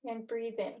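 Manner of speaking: calm guided breathing cues, long pauses. Acted. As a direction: lead the breath counts calmly.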